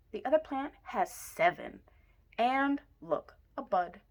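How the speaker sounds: ordinary delivery, nothing marked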